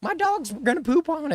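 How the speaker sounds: whiny entitled neighbour, hammed up; mock Karen. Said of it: "Some Karen just call me".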